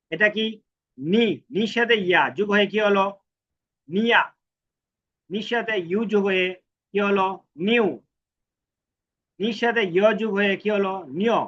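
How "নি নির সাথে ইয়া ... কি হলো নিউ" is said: ordinary talk, nothing marked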